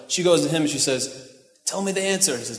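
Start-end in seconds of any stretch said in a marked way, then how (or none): 1.6-2.4 high, insistent wife's pestering; nagging wife. Acted. Wife asks Samson for the riddle's answer, nagging. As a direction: pester him in a raised pitch. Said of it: "She nagged him".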